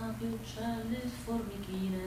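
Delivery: slow, fading hushed recitation; poem recital. Acted. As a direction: let the lines drift off into silence. Recites poem over music.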